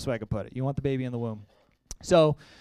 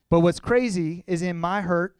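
usual speaking voice

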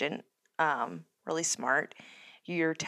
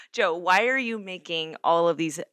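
ordinary talk

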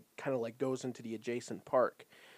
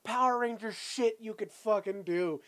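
exasperated; 9 semitones higher, 5 dB louder than usual. Real, not acted. Laughing exasperated outburst.

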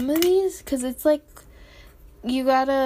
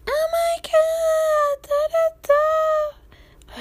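high shrieking fan scream, slowed; 5 dB louder, much slower than usual. Retold or imitated. Crowd screaming at Seventeen members, high shriek. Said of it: "everybody screamed".

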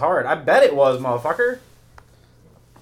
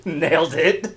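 laughing; 4 semitones higher, slower than usual. Real, not acted. Laughing after joke.

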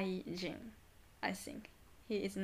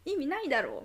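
louder, higher mock anger in Tokyo Japanese rather than Kansai-ben; Tokyo-style angry quote; 6 semitones higher, 6 dB louder, much faster than usual. Angry stranger on phone, mock Tokyo-Japanese snap. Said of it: "これは関西弁か".

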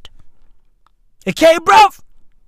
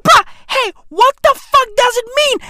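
high, loud, fast disbelief; comically worked-up, high-pitched incredulity; exasperated outburst; 12 semitones higher, 4 dB louder, faster than usual. Retold or imitated. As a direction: demand an answer in mock outrage. Acts out his outraged question.

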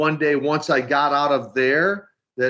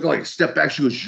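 ordinary talk

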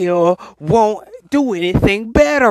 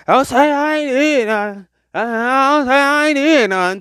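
higher, whiny imitation of friends; put-on aggrieved friend voice; mock complaining; 6 semitones higher than usual. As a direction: moan it like friends wanting credit. Imitating friends claiming credit.

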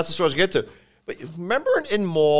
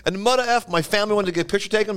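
higher, indignant comic imitation; aggrieved club owner; 8 semitones higher, much faster than usual. Club owner tells how his family was refused a picture, aggrieved. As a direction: complain as the snubbed owner. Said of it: "And he goes".

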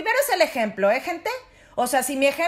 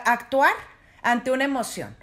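normal talking voice

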